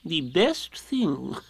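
very slow, sage owl voice; wise Owl. A narrator's reading. Owl approves of Rabbit doing nothing, wise. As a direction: stretch it out wisely, chuckle after. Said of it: "said Owl wisely".

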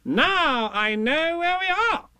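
high, slow triumphant Rabbit. Acted. Rabbit seeing the sand pit again, high triumphant. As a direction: announce it proudly. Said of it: "Rabbit said triumphantly".

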